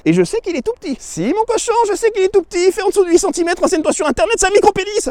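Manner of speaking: high, sing-song teasing voice; high teasing mockery; mocking taunt. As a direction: needle him with a high mocking tone. Mocking the viewer.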